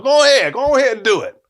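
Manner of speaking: loud, high goading retort; high, forceful mock challenge; defiant dare. Retold daring teacher to write referral.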